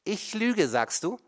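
high, loud, indignant; outraged question. A narrator's reading. Mother challenges her son, high and indignant. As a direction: throw his word back at him.

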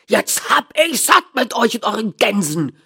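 high, loud furious dealer; angry shout. A narrator's reading. Dealer shouting at a small man about geese, furious. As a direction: shout as if fed up to the limit. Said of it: "schrie er dabei".

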